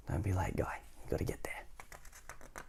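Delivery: urgent whisper to the hero. Shown as a demonstration. Narrator urging Mister Brave along, whispering. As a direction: hurry him along under the breath. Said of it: "whispered urging".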